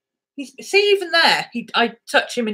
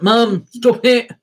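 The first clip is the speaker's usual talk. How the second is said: mock embarrassed dog; 3 dB louder than usual. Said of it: "He's like".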